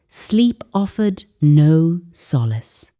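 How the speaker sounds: ordinary delivery, nothing marked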